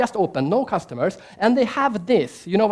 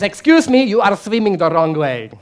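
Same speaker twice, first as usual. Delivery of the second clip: a stranger loudly telling off a swimmer; stern German man; 7 dB louder than usual. Retold or imitated. German man scolds brother in pool.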